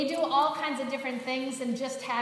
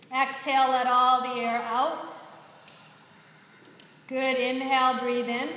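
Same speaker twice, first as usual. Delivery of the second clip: louder breathing cues, spaced by long pauses; guided breathing; 4 dB louder than usual. Acted. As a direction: coach the breath aloud, step by step. Leads the breathing practice.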